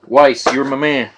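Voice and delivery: ordinary talk, nothing marked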